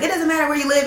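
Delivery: mock scream. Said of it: "I used to scream".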